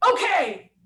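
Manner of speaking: mock coach yell; yelled okay. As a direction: bark it out like a coach. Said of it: "he kinda yelled it at me".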